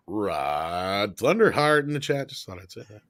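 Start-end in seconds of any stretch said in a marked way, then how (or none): 0.1-1.8 drawn-out, swooping announcer-style name; showy name call. Pretend. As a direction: stretch the first word like a hype announcer. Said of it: "have to say the name".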